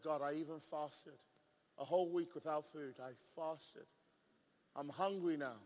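low, quiet, deflated grumble; sulky retelling. Retold or imitated. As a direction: complain softly and low to God. Sulky retold complaint to God.

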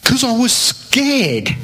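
high, drawn-out mock protest; exasperated protest. Preacher defends himself to the host, mock exasperated.

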